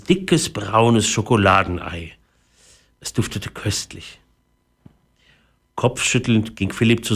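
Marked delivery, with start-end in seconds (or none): 3.0-4.2 soft savouring whisper. Acted. Narrator whispering how good the chocolate smells. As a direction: breathe it like a delicious secret.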